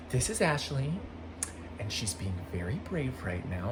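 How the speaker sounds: soft, hushed reassuring mother; gentle mom. Retold or imitated. Mom introducing her scared daughter to the Grinch, hushed and gentle. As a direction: murmur it softly to calm a nervous child.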